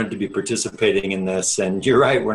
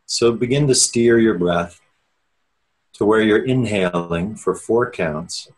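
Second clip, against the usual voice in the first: measured, unhurried meditation guide with long pauses; steady, spacious meditation-teacher delivery; guided meditation. Real, not acted. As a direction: ease listeners inward, leaving space after each phrase. Leads an opening meditation.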